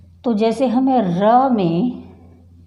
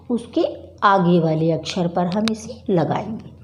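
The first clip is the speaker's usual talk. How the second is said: ordinary talk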